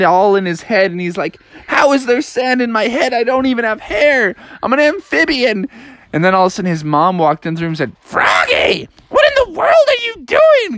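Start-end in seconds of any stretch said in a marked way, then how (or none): 1.7-5.7 baffled. Acted. Baffled frog finds sand on head. 8.0-10.8 very high, shrieky scolding mother; shocked mom. Acted. Mom finding Froggy on the floor, shrilly shocked.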